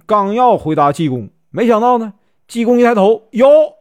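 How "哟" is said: sudden high exclamation of surprise; a startled, teasing yelp; high, louder mock surprise; surprised "yo". Jigong spots the Taoist.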